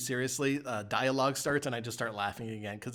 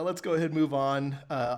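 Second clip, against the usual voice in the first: ordinary talk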